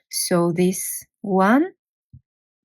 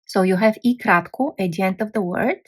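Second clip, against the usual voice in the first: ordinary talk, nothing marked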